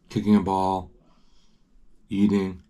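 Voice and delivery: ordinary delivery, nothing marked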